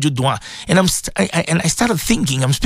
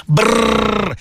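long trilled "brrr" at the cold; playful sound of feeling cold; shivering brrr; 2 semitones higher than usual. Pretend. Remarks on the cold morning.

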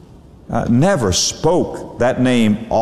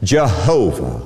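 ordinary talk, nothing marked

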